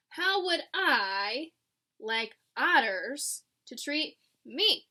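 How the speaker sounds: drawn-out pondering question; slow musing. Acted. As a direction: stretch each word as you think.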